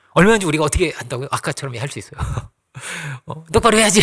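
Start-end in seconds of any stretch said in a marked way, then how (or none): 3.5-4.0 stern scolding. Pretend. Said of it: "이럴 수 있고요".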